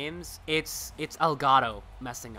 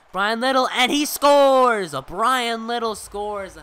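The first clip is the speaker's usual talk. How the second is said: loud, slow, drawn-out goal yell; goal call; 7 semitones higher, 12 dB louder, slower than usual. Acted. Announcer shouting the goal to the viewers, slow and loud.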